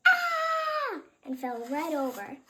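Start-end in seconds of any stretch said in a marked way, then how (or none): 0.1-1.0 high loud shriek; high startled yelp; yelled scream. Acted. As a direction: scream in fright. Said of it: "The man yelled".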